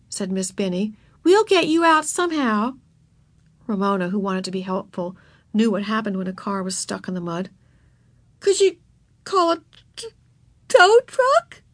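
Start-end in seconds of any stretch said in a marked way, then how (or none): 1.2-2.7 higher-pitched encouraging teacher; reassuring teacher. A narrator's reading. Teacher promising to free the girl, reassuring. 8.4-11.6 very high little voice, catching on sobs; tearful sniffling child. A narrator's reading. Stuck girl asks teacher for a tow truck, tearful. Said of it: "she asked with a big sniff".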